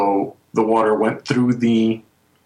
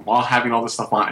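usual speaking voice

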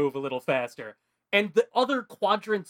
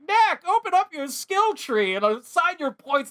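high, louder instructional game voice; game tutorial voice; 12 semitones higher, 5 dB louder than usual. Retold or imitated. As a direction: announce it like a cheery in-game prompt. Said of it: "it's like".

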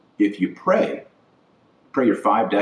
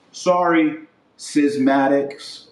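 mock-apologetic, higher, with a slow drawn-out schismatics; sneering fake apology; sarcastic sorry; 6 semitones higher, much slower than usual. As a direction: fake sympathy, dragging the last word. Mocking apology to traditionalists.

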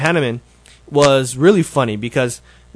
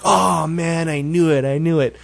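retold groaning realization, starting higher; mock exasperation; 5 semitones higher than usual. Mayor learning why he got those questions, mock exasperation. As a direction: imitate a mayor who's been caught out.

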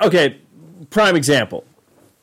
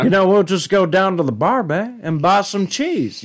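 Hank Hill; 4 semitones higher than usual. Shown as a demonstration. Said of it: "literally just Hank Hill just going".

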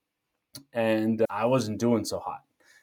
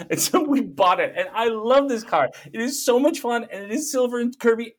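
much higher, bubbling over with joy about the car; excited gushing; 9 semitones higher than usual. Real, not acted. Gushing about his new car.

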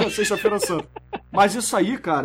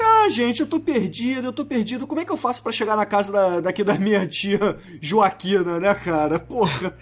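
lost woman voice; 2 semitones higher than usual. Retold or imitated. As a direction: ham up a lost city girl. Voicing lost city woman.

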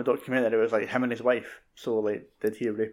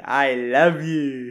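slow, louder, drawn-out sly declaration; grinning Tim Curry; 6 dB louder, much slower than usual. Retold or imitated. Tim Curry addressing the kneeling crowd, slow sly grin. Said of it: "he's like, with a wee grin".

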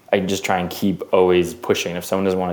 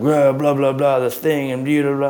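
mock their jargon, high and bored; mock gear talk; 6 semitones higher than usual. Mocking crew's gear talk.

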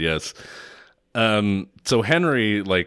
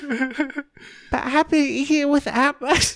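put-on very high, slow, laughing baby voice; childish whine; much slower than usual. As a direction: stretch it out like a whiny child. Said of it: "And she goes".

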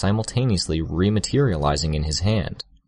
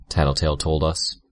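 ordinary delivery

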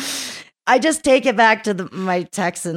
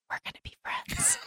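creepy whisper in a new friend's ear; breathy, voiceless whisper, very soft; 14 dB quieter than usual. Retold or imitated. Climber whispering to the woman she stalked at a festival, whispered. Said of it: "I whispered in her ear".

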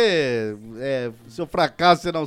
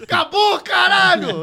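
high yelled curse; shout; 10 semitones higher, slower than usual. Retold or imitated. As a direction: yell it, fed up. Shouting back the breakup.

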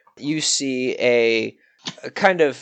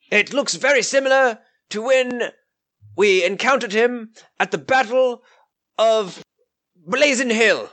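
higher voiced knight, dutiful; the knight reporting; 10 semitones higher than usual. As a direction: report to the lord with formal respect. GM voicing the knight.

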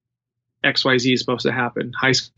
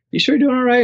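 pitched-up, louder worried question; concerned check-in; 11 semitones higher, 4 dB louder, faster than usual. Retold or imitated. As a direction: ask it with sudden concern. Coach checks on struggling athlete.

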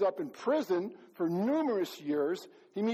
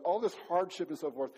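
ordinary delivery

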